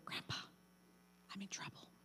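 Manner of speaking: breathy whisper, nearly soundless. Retold or imitated. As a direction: whisper it like a scammer in the dark. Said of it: "or they whisper".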